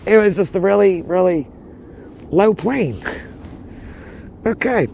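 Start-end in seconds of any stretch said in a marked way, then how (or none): 0.0-3.0 excited. Real, not acted. Distracted by plane.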